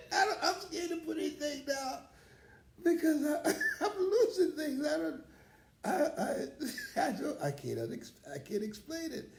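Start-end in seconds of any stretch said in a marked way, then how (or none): 0.1-7.4 laughing. Real, not acted. Laughing about losing things.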